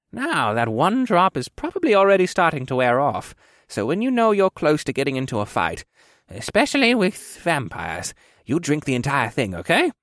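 put-on old quartermaster explaining carefully; instructive old quartermaster. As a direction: advise like an old mentor.

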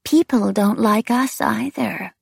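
high, slow, lilting wraith; eerie taunting wraith. A narrator's reading. Wraith claims kinship with the narrator, eerie and taunting. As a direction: needle them in a high, drawn-out lilt.

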